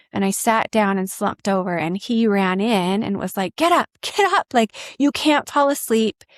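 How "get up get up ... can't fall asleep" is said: high, urgent, half-laughing command; mock-panicked doctor rousing a fainting patient. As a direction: pitch up and push him to rouse her. Doctor urging her awake.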